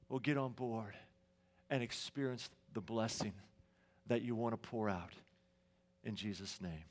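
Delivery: soft, hushed pastoral prayer, fading low at the end. Real, not acted. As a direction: pray quietly and earnestly for the congregation.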